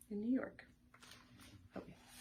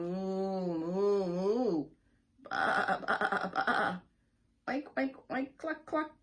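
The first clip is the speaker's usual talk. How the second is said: loud mooing, baaing, oinking; loud playful animal calls; farm animal noises; 11 dB louder, much slower than usual. Acted. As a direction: make the barnyard sounds loudly. Animal noises read aloud.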